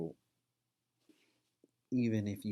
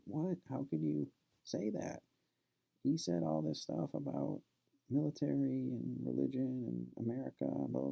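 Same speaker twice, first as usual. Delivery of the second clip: pitched-up voice of a shocked student, imitated; incredulous outrage; 8 semitones higher than usual. Student protesting to his parents, high incredulous retold outrage. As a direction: imitate the kid's disbelief, voice much higher.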